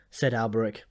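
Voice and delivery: ordinary delivery, nothing marked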